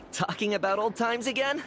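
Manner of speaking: ordinary talk, nothing marked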